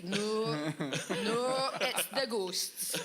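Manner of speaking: loud, drawn-out mock insistence; insistent mum. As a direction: imitate a superstitious mum, stretching each word.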